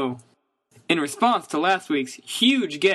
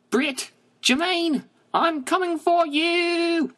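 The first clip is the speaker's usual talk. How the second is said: much higher, slow hammed-up rallying cry; mock battle cry; 11 semitones higher, slower than usual. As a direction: charge off to fight, hamming it up. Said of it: "[name] of Flight of the Conchords".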